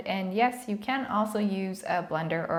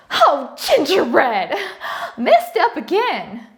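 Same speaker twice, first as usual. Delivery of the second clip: slow, loud southern drawl on "Oh, gingerbread"; mock-curse in a Southern accent; Southern belle cursing with cookie names; 7 semitones higher, 8 dB louder, slower than usual. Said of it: "getting a southern accent doing this".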